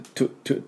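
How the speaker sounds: "トゥ" as a soft voiceless burst; short whispery t-w release on "トゥ"; fused tw. Shown as a demonstration. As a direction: puff "トゥ" without a full vowel. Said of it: "じゃなくて、".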